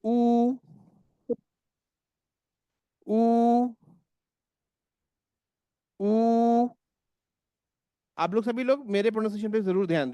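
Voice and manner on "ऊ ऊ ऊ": ऊ held long and rounded, higher and louder. Shown as a demonstration. Teacher drilling the German U sound for the class. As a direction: stretch each ऊ into a long rounded oo. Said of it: "This U behaves like double O".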